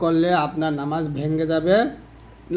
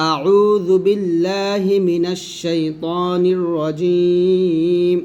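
slow, drawn-out recitation; measured tajweed recitation; Quran recitation; much slower than usual. Shown as a demonstration. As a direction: chant it slowly with long vowels. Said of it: "তারপরে দেখুন আমি যদি ভুল করি".

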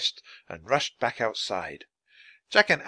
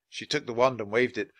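ordinary delivery

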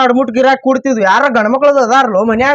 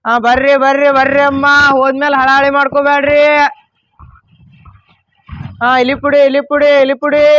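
sing-song hawker's call on a steady pitch; street vendor's cry; 2 semitones higher than usual. Acted. Seller hawking rat poison to the street, vendor's cry. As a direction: call out your wares to passers-by, drawing out line ends. Seller calling customers over.

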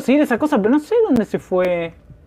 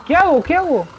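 high, tense panicky voice; high-pitched jittery fear; scared; 9 semitones higher than usual. Real, not acted. Panicking while hiding in horror game.

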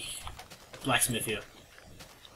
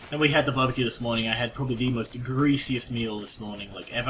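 usual speaking voice

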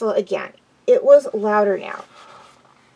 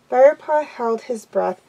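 ordinary delivery

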